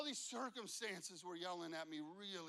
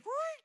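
very high, loud imitated screech; everything-stops screech; 13 dB louder than usual. Whole restaurant stops at the challenge, sharp imitated screech. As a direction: imitate a sudden screeching halt. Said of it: "It's like".

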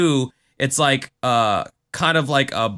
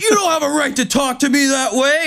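road rage; 12 semitones higher than usual. Retold or imitated. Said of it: "he's like".

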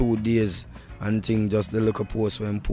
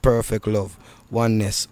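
usual speaking voice

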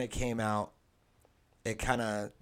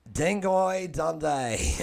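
put-on slow, drawn-out Australian accent, pitched up; 5 semitones higher, much slower than usual. Podcast host mocking Australians, slow hammed Australian accent. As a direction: stretch "Dingo Dundee" out like a broad Aussie. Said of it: "the best Australian accent I've done all day".

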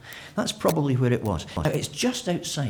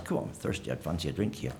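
softer, throwaway tone; casual offhand request; 4 semitones lower, 6 dB quieter, faster than usual. Retold or imitated. Preacher mocking a trivial reading of Jesus' thirst, casual and offhand. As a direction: toss it off like asking for a pint. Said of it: "He wasn't just saying".

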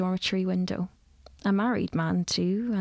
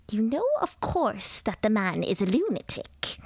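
lecturing lady; 3 semitones higher than usual. A narrator's reading. Mrs Dawson lectures the nervous girl about new tea, grandly. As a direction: pronounce on tea like an authority.

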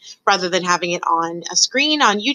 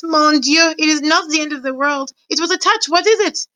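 high-pitched, fussing French mother; dramatic, high maternal protest; mother's voice; 9 semitones higher than usual. A narrator's reading. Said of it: "heard his mother's voice".

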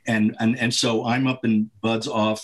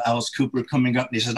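normal talking voice

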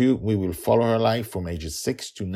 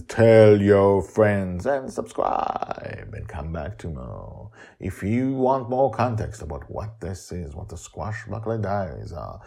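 usual speaking voice

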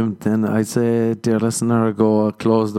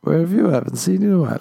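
mock friend greeting; 9 semitones higher, 3 dB louder, much faster than usual. Acted friend greeting after stroke.

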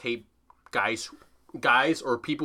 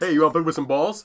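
mock kiosk pitch; 4 semitones higher, 7 dB louder, faster than usual. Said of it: "That's what I would say".

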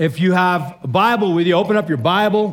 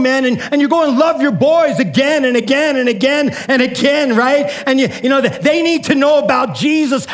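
retold preaching shout; 3 semitones higher than usual. Pastor exhorting a stranger to go home, shouting. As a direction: preach it hard at him, almost yelling. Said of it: "Why are you yelling at me?".

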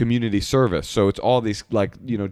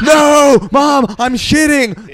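high, slow yelled protest; panicked shout; 9 dB louder, much slower than usual. Retold or imitated. Son on the toilet yelling at charging mom, panicked. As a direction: scream it in panic. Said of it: "I'm like".

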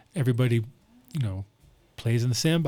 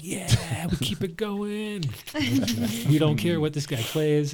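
stoned partier voice; 6 semitones higher, slower than usual. Retold or imitated. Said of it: "They'd be like".

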